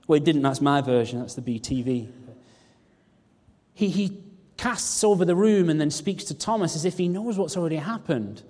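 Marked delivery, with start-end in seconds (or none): none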